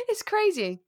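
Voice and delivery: laughing. Real, not acted. Laughs while answering.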